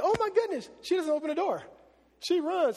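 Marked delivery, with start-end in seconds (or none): 0.0-0.7 high, thrilled squeal of joy; a servant girl bursting with excitement; excited gasp. Acted. Servant girl telling of Peter, excited squeal. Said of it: "She's so excited".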